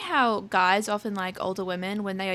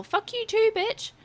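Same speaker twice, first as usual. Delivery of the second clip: put-on kid's bratty yell; 10 semitones higher, slower than usual. Kid yelling back as she walks off, high bratty yell. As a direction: shriek it like a taunting brat. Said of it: "the kid had to dig the knife deeper and yell".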